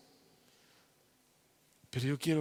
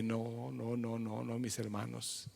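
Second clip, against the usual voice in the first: soft fading plea; 3 semitones lower, 9 dB quieter than usual. Real, not acted. Pleading no, fading.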